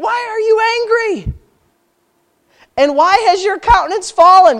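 God confronting Cain, high and stern; God's voice. Acted. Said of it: "And the Lord said unto Cain".

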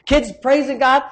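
usual speaking voice

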